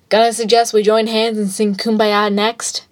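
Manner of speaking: mocking quip. A narrator's reading. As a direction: toss it out as a sarcastic suggestion. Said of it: "he quipped".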